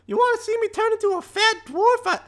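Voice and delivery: very high, showing-off character voice; high cocky character voice; boastful high voice. Acted. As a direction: brag to impress the kid. Owl shows off to girl.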